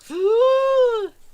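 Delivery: sharp high gasp of wonder; awed gasp. Retold or imitated. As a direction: gasp in amazement. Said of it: "I'm like".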